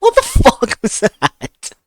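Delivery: high, loud, slow shocked cry; shocked shriek. Real, not acted. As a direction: screech it at the scare. Jump scare in game.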